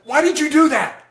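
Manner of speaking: high, exasperated mock-parent demand; scolding parent. Parent to child who messed up, accusatory. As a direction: imitate a parent's accusing outburst. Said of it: "what did your parents say".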